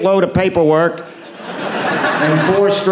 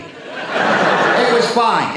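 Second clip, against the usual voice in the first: slow tease; 5 semitones higher, much slower than usual. Shown as a demonstration. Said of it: "it's not gonna be pretty".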